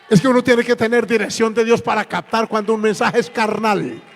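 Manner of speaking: loud, high shouted admonition; forceful pulpit shout; emphatic preaching. Real, not acted. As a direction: drive the lesson home at full force. Preacher drives the lesson home.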